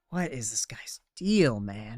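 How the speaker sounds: breathy whispered aside; hushed disbelieving whisper. Real, not acted. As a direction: whisper it under your breath. Unvoiced aside.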